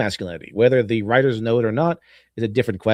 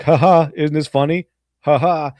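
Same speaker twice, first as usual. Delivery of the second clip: sing-song fake chuckle, pleased with itself; a put-on voice laughing at its own joke; mock smug laugh; 2 semitones higher, 4 dB louder than usual. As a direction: play the smug movie nudging the audience. Mocking the movie winking at audience.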